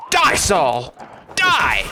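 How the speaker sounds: hollering die at the foe; hammed-up kill shout; mock battle yell. Yelling at an enemy in game.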